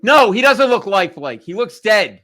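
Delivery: loud, high indignant correction; hammed-up exasperated rant; exasperated outburst. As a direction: shout down the polite nonsense. Rants against funeral small talk.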